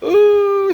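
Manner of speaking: loud, very high "ooh" wail; mock crying wail. Storyteller mimicking the slapped woman, mock crying wail. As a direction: imitate someone bursting into tears. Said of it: "she started crying".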